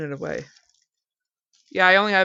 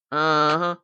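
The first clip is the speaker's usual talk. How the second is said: usual speaking voice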